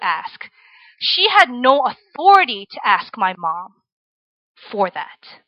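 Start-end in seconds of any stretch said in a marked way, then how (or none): none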